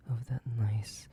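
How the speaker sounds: slow, soft whisper; slow hungry whisper. Acted. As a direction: savour the words in a whisper. Hungry whisper before bite.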